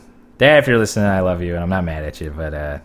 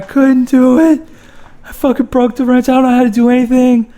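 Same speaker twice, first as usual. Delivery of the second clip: mock sobbing, much higher and louder; blubbering cry; 6 dB louder than usual. To his wife after failing the oil change, hammed-up crying. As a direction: wail it like a blubbering baby. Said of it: "started fucking crying like a blubbering baby".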